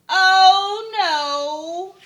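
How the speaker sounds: loud high oh no; alarmed. A narrator's reading. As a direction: gasp out in alarm. Narrator alarmed at tapping.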